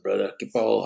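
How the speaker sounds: usual speaking voice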